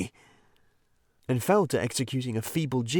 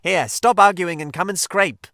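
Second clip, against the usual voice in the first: Rat, louder and higher, bossing him; exasperated order; 8 semitones higher, 9 dB louder than usual. Acted. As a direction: cut the argument short.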